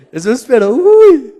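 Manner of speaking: sudden high loud "uy"; startled cry. Real, not acted. Preacher startled mid-sermon, sharp cry. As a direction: jump at something unexpected.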